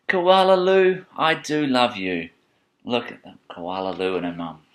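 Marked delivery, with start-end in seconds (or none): 0.1-2.3 name called high and loud, then dropping; fond mother. Acted. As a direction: sing out the name, then slow down fondly. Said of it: "she would laugh and shake her head and say".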